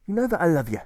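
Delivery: deserter answering. A narrator's reading. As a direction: assure him with emphasis. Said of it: "deserter answers Alfred".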